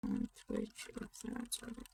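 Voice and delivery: unvoiced murmur to herself; whisper. Real, not acted. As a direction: whisper the words as you type. Whispers while typing.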